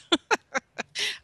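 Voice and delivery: a sudden high burst of laughter; high self-mocking laugh. Real, not acted. Laughs at her own idea.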